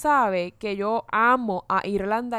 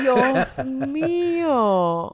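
drawn-out swoon; 4 semitones higher, much slower than usual. Real, not acted. Drawn-out swoon over the handsome actors. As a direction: stretch Dios mío, dreamy and very slow.

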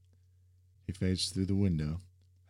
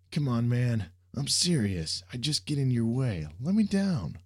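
higher, louder pleading to be released; agitated protest; 7 semitones higher, 5 dB louder than usual. A narrator's reading. Sam struggling in Jack's grip, protesting loudly. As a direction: protest hard, jumping up in pitch at the end. Wriggling to get free, protesting.

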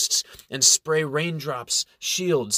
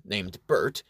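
ordinary delivery, nothing marked

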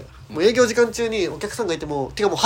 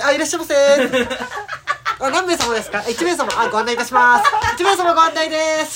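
bright higher staff greeting; bright loud shop welcome; 7 semitones higher than usual. Retold or imitated.

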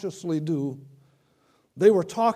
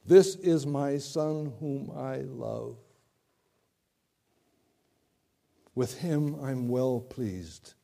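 solemn; 3 semitones lower, slower than usual. Acted. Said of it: "And the voice says".

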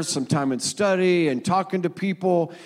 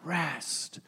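"Rest" repeated much softer, almost breathed; a hushed, falling "Rest" after the promise; soft echo; 2 semitones lower, 12 dB quieter than usual. Acted. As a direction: let the word "Rest" fall away quietly. Repeating the last word softly.

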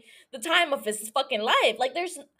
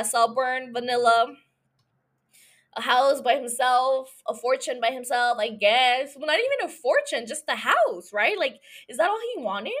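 usual speaking voice

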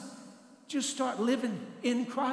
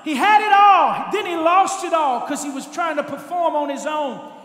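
loud, high preaching cry; preaching at full force; 4 semitones higher, 10 dB louder than usual. Acted. Pastor preaching to church about the prodigal, loud and high. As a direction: drive it home to the congregation.

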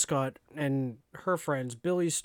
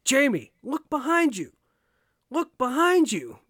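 high, raised-voice imitation of yelling at the TV; mock yelling; 10 semitones higher, 6 dB louder than usual. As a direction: play an urgent warning shouted at the screen. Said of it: "yelling at the T V".

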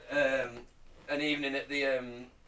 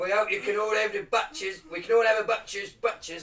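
high, loud, hammed-up Cockney; Cockney accent; 7 semitones higher, 7 dB louder than usual. Performing a Cockney Lord's Prayer for friends, in exaggerated Cockney. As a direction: lay the Cockney on thick and loud. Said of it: "how do they talk?".

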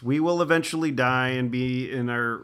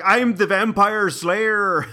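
put-on slow, loud, higher, wobbly Irish-Jamaican accent; mock a bad slayer accent, drawn out; terrible mixed accent; 6 semitones higher, 6 dB louder, much slower than usual. Mocking a TV slayer's awful accent, slow and loud. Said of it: "Irish at some point ... but Jamaican at others".

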